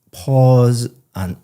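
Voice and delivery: drawn-out filler sounds, "mm" and "uh"; audible hesitation. Shown as a demonstration. Teacher showing a student what unnatural pauses sound like, hesitating. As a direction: stall audibly as if hunting for words. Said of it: "Audible pauses like that".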